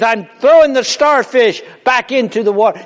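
loud, high explaining; starfish man. Retold or imitated. Man on the beach answers the passerby, loudly. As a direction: answer him plainly but big. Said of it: "He says".

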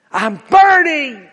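high, loud anguished cry; crying out burning. Retold or imitated. Witness recalls the trapped man's dying cry, high and loud. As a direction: wail it out in agony. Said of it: "one of the witnesses said".

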